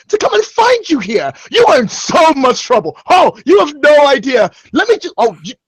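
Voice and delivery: high-pitched, outraged parental tirade; furious scolding. Acted. Father berates the son he crossed oceans to find, furious. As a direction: scold him at full indignant pitch.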